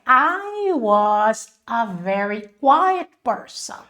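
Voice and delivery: very slow, evenly spaced English; slow model sentence. Shown as a demonstration. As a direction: say it slowly for learners to follow. Teacher models English sentence slowly.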